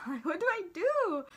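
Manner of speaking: high, hammed-up helpless question; mock panic. Unsure how to tell boyfriend, comically panicked. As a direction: let it swoop up in comic despair.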